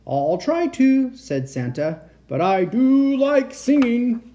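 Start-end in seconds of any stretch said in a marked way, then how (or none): 0.1-1.1 high, loud, drawn-out Santa reply; jolly Santa. A narrator's reading. Santa answering the reindeer, big and jolly. 2.3-4.2 high, loud, slow Santa voice; jolly Santa. A narrator's reading. Santa excusing himself to the reindeer, loud and jolly. Santa protests that he likes singing.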